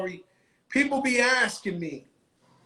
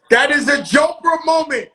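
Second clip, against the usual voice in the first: excited shout; 3 semitones higher, 8 dB louder than usual. Real, not acted. Hyping up Joker moment.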